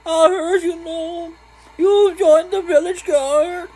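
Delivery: put-on very high, creaky old-lady falsetto; frail, reedy granny falsetto; elderly woman. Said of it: "elderly woman voice".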